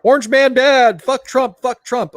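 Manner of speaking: high, slow mock chant of a dumb protester. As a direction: parody the mindless slogan. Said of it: "I'm like".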